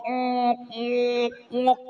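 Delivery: three short snorting snores; imitated snore. Preacher mimics a happy husband snoring in bed.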